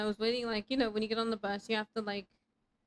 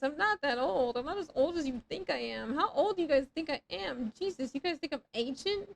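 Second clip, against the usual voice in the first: normal talking voice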